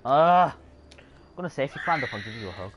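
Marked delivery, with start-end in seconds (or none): 0.0-0.5 loud, high groan of frustration; frustrated groan. Real, not acted. Gamer fed up with Ezio not climbing, frustrated groan. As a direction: let out a loud exasperated "ugh".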